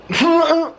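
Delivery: high, loud comic noise of falling; sudden high-pitched mock tumbling cry; tumbling yelp. Acting out his fall down stairs, high loud yelp.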